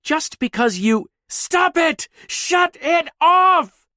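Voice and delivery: very high, loud, slow screech. Acted. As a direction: scream it until it rings. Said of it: "Her screech rang in the concrete".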